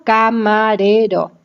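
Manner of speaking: "camarero" slowly as ca, ma, re, ro; syllable by syllable. Shown as a demonstration. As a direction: give each syllable of "camarero" its own beat: ca-ma-re-ro. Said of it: "Una sílaba es abierta".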